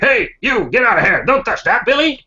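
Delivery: put-on high-pitched cranky old man scolding. Old handyman warning Billy off the wires, cranky. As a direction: snap crankily at a meddling kid. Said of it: "go cranky, like, instantly".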